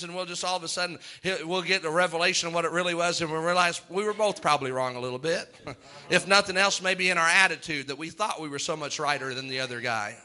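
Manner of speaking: ordinary talk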